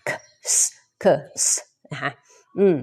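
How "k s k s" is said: "K" as a short puff, then "S" as a separate hiss; K and S separated. Shown as a demonstration. Teacher tells learners to practise separately, sounded out. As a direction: break it into "K", pause, "S". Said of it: "ให้แยกออกมาฝึก".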